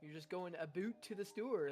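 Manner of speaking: Americans' exaggerated Canadian impression; mock Canadian with "a boot" for about; mocking Canadian accent. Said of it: "a lot of Americans do that".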